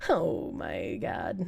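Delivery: low, hushed laughing; laughing under breath. Real, not acted. Streamer laughing at own joke, quiet and low.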